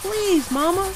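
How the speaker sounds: high, loud cub calling to Mama; shouted plea. A narrator's reading. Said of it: "shouted Brother".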